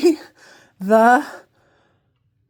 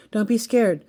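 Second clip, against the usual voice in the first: ordinary talk, nothing marked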